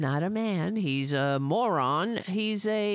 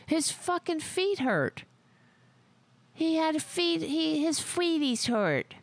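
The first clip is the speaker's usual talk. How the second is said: high mocking baby voice; mocking baby talk; 9 semitones higher than usual. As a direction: whine it like a baby. Mocking bone spurs.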